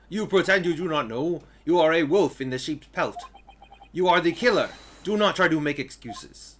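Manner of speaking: loud, forceful accusing rant; loud furious Russian-accented accusation; angry accusation. Acted. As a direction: hurl the accusation at the killer. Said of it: "I cannot do a Russian accent".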